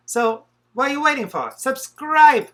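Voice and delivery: loud, high hard-sell pitch; infomercial voice. Acted. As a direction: sell it like a TV ad host. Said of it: "That sounded like an infomercial".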